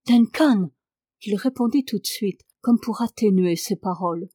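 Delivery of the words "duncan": Duncan called out louder, reproachful fall; reproachful cry. A narrator's reading. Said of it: "je lui criais avec un tel accent de reproche". (« Duncan » crié avec reproche)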